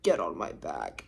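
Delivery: very low, soft imitation of a man; mock-manly low murmur; gruff man. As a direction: drop way down for a reluctant hero. Imitates the man's grudging offer.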